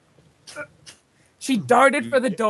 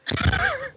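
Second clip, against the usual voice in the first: high giggle. Real, not acted. Narrator giggles.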